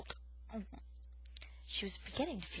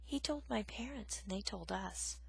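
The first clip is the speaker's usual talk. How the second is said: timid confession; 8 dB quieter than usual. Acted.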